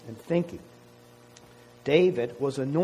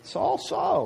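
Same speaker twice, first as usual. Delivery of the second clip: high ringing call of the name; Jesus calling Saul. Retold or imitated. Jesus calling Saul on the Damascus road, high urgent call. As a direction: call out to him from heaven, pitched high. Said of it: "what does Jesus say to him".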